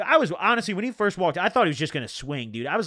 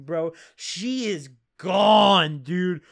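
usual speaking voice

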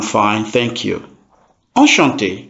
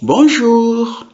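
bonjour sung out much higher, rising bright; cheerful high bonjour; 11 semitones higher than usual. Shown as a demonstration. Teacher shows a higher friendly bonjour tone. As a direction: greet with a high lilting bonjour. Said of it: "Depending on your tone".

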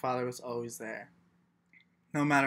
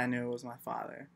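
usual speaking voice

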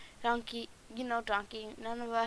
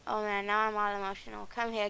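ordinary talk, nothing marked

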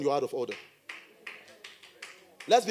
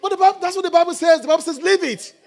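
much higher, loud, laughing "the Bible says"; excited emphasis; 11 semitones higher, 9 dB louder than usual. Real, not acted. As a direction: hammer the scripture home with a laugh.

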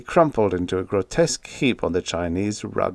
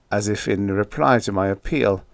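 usual speaking voice

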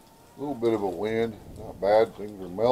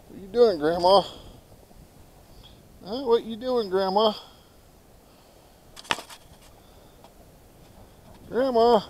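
higher-pitched coaxing chat to a hen; pet talk; 8 semitones higher than usual. Real, not acted. Keeper greeting his hen Grandma, higher pet-talk voice. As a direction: talk to a favorite animal, pitched up.

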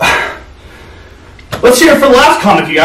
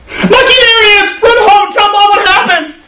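panicked shouting; 9 semitones higher than usual. Pretend. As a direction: scream at him to run. Mouse warns brother, cat arrives.

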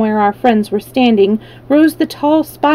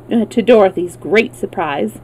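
plain reading voice

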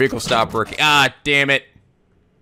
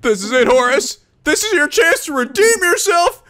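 high-pitched, tearful pep talk that cracks into panic; anguished Horace; 5 dB louder than usual. Acted. Horace fighting back bad memories, anguished. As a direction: play an overwrought hero, pitched up and wailing. Voices Horace psyching himself up.

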